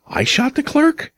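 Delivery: "I shot the clerk?" leaping very high, rising on "clerk"; incredulous question. Acted. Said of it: "it is no longer a question".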